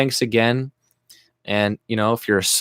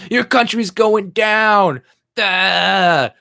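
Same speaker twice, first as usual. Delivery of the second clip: high, slow taunting sneer, much higher and louder; mocking European commenter; 10 semitones higher, 5 dB louder, much slower than usual. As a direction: play a smug troll jeering. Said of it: "you got these fuckers".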